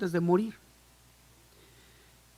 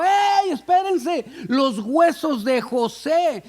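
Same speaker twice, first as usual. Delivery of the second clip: high, loud, slow drawn-out cry; shout; 8 semitones higher, 6 dB louder, much slower than usual. Acted. Someone in the crowd calls Moses back, loud slow shout. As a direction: call out to stop the crowd leaving. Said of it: "alguien gritó".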